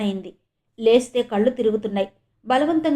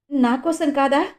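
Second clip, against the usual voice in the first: a choked question, pushed out with effort, a little higher; forcing the words out; 4 semitones higher than usual. A narrator's reading. Wife asking her husband if it is for her, forcing the words out. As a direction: squeeze the words out past a tight throat. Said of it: "బలవంతంగా గొంతు పెగుల్చుకుని".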